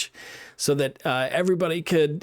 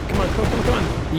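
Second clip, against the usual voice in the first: excited urging; 7 semitones higher, faster than usual. Real, not acted. Urging the jump in game.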